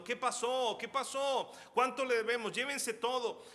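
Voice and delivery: high, loud, desperate cries; panicked screaming. Acted. Family screams as soldiers break in, panicked. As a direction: cry out in terror for mercy. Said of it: "empiezan a gritar diciendo".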